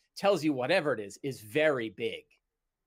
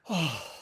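acted sigh of relief; big sigh; 3 semitones higher than usual. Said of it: "like a big sigh".

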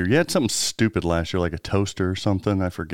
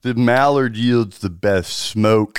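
pompous connoisseur; 4 dB louder, much slower than usual. Acted. Mock connoisseur about duck smoking.

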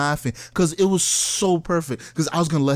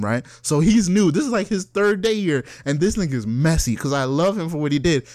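normal talking voice

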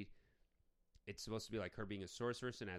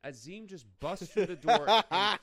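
laughing; 5 semitones higher, 10 dB louder, slower than usual. Real, not acted. Laughing disbelief at the movie.

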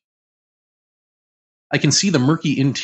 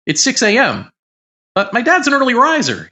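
giddy excitement; 11 semitones higher, 5 dB louder than usual. Retold or imitated. Giddy after the experience.

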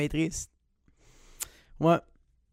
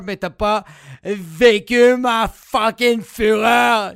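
furious; 3 semitones higher, 8 dB louder, much slower than usual. Acted. Rant about grandmother's remark.

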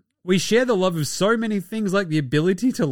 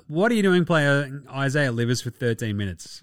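normal talking voice

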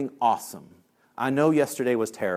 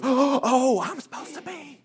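high gasping mock surprise; gasp, then squeak out the realization; sudden realization; 12 semitones higher, slower than usual. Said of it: "She pops up and she goes".

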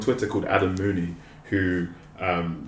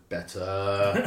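Andy Townsend; 4 dB quieter than usual. Retold or imitated. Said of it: "I'm a fan of the Andy Townsend".

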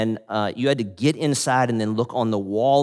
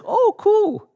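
squeaky, much higher, delighted little voice; a high, bright "oh, cool" from a delighted wife; sweet old wife; 7 dB louder than usual. Retold or imitated. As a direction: pitch way up as a sweet excited old lady. Jan voiced reacting.

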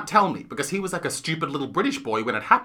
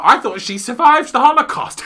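higher, louder mock astonishment; imitating Johnny; 8 semitones higher, 9 dB louder than usual. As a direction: protest in naive disbelief, as a retold quote. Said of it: "beautiful British Johnny".

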